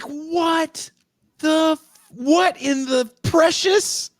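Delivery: very high, drawn-out incredulity; stunned disbelief. Retold or imitated. Viewer lost for words at the movie's twist, stunned. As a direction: sputter slowly, exaggerating the shock.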